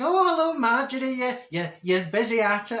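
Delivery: high, sing-song neighbour's hello; chirpy pal calling over the garden wall; Senga's greeting. Retold or imitated. Pal Senga greets Marjorie at her garden, high and bright. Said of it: "my pal Senga walks by and she says".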